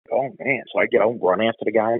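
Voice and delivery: normal talking voice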